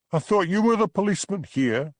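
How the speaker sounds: high, loud mocking jeer. A narrator's reading. Bella mocking the village constable, high loud jeer. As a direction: sneer it to mock him. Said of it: "she jeered".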